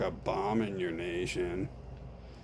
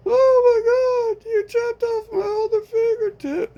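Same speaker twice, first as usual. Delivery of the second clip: much higher, loud, slowed cry of pain; shrill victim shriek; 10 dB louder than usual. Acted. Victim to friend after the chop, high howl. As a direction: scream out the shock of losing it. Victim finds fingertip chopped off.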